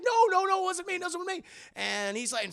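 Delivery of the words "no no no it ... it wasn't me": very high-pitched frantic protest; mock panicked denial. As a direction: play someone desperately pleading innocence. Acted panicked denial at gunpoint.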